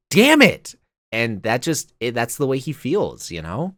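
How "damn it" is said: a much higher, louder "Damn it"; frustrated outburst. Retold or imitated. Roman swearing after his fall, imitated as a high, loud frustrated outburst. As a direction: blurt it out like a fed-up seven-year-old. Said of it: "He's like".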